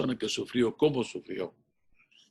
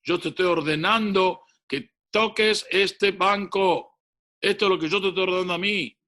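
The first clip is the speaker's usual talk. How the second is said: higher, louder commanding shout; shouting order; 6 semitones higher, 3 dB louder than usual. Retold or imitated. Father shouting an order at his son, loud and commanding. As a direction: order him sternly. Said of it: "Y el padre le gritó".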